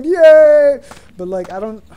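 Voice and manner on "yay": loud, high yay; excited cheer. Real, not acted. Castaway glad he isn't alone on the island, cheering. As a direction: burst out with relief.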